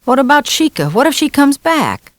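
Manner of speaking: put-on high, pleading young boy; lightly voiced anxious boy; child's voice. A narrator's reading. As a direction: raise it to a worried kid.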